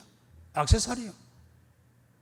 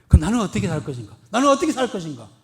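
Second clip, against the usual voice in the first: resolute question; 2 semitones higher, 7 dB louder, faster than usual. Retold or imitated. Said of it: "생각을 해, 생각을".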